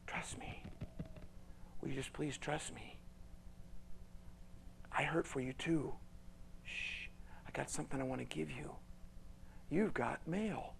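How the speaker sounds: soft, hushed pleading; quiet tender reassurance. Acted. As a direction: coax gently like a loving father. God tenderly soothing.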